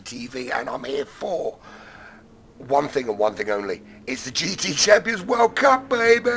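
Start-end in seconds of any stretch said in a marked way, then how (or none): 4.1-6.3 big, loud, high hype opening; hyped opener. Real, not acted. Caster hyping fans for the World Cup: excited shout. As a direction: fire up the race fans at the start.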